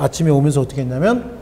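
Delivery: ordinary talk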